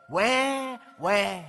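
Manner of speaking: imitating a crying infant; baby crying. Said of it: "all you have to do is say".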